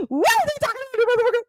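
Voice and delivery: high, fast outraged squeal; heated Tasha K. Retold or imitated. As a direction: rant high and fast at the traitor lawyer. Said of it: "She got up on that backup chair".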